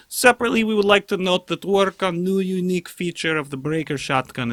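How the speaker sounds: mock Russian accent with a comrade wink. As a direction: read the dev notes in a joke Russian accent. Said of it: "I should read it in a Russian accent".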